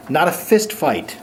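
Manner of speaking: ordinary talk, nothing marked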